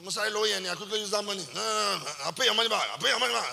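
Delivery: lightly voiced excuse-maker; imitating his way of talking. Man confronted by uncle promising repayment, lightly imitated excuses. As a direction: do a light take-off of him making excuses. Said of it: "that's how he talks".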